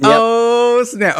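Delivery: high, drawn-out "oh" of sudden recall; sudden realisation. Retold or imitated. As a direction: blurt it high, acting out a thought popping up. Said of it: "and I was like".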